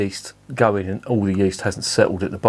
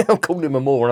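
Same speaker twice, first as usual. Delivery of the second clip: laughing; 5 semitones higher, faster than usual. Real, not acted. Spills beer mid-rant, laughing.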